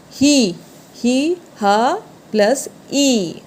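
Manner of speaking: ही stretched slowly, split as हा plus ई; slow syllable breakdown. Shown as a demonstration. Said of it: "देखिएगा कैसे उच्चारण होता है". (ही को धीरे-धीरे, हा प्लस ई में तोड़कर बोलना)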